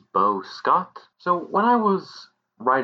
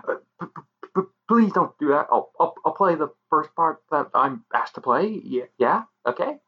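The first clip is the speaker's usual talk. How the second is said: scared stammer; 6 semitones higher than usual. Acted. Extra stammering, scared of threat.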